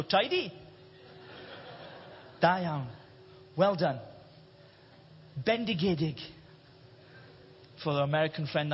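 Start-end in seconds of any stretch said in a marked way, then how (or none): 0.0-6.2 soft Welsh lilt, words dropped lower; Welsh accent. Pretend. Preacher answering God in heaven, mild Welsh accent. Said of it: "of course with a Welsh accent".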